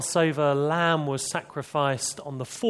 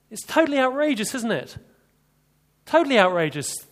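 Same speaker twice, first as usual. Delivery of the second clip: indignant outburst; 8 semitones higher, 4 dB louder than usual. Real, not acted. Preacher exclaims indignantly.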